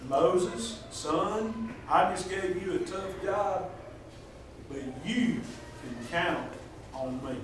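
soft, reassuring fatherly God; tender reassurance. Retold or imitated. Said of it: "God was saying".

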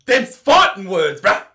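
loud, slow mock challenge; mock fighting words. Reacting to a video, hammed-up tough-guy challenge. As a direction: square up, hammed-up.

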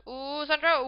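high, loud ooh; excited near-miss cry. Real, not acted. Gamer reacting to a close shot, excited shout. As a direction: cry out the player's name at the chance.

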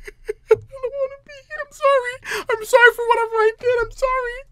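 very high squeaky whimper; tearful pleading. Real, not acted. Player apologising to the game's characters, tearful pleading. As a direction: beg in a high crying whine.

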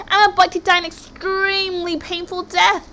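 high-pitched, gleeful announcement; excited squeal. Acted. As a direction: squeal as if doom were a treat. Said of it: "squealed Halfdan".

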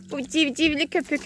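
ordinary talk, nothing marked

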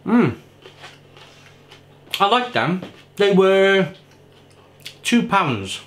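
chewing while talking; mouth full, chewing. Real, not acted. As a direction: food in mouth. Seen 0: Bit food at -5; jaw and cheeks show chewing while speaking.

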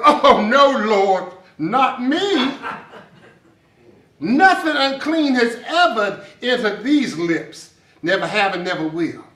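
loud, indignant refusal; mock self-righteous protest, easing off at the end. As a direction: protest with hammed-up piety. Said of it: "And self-righteous Peter".

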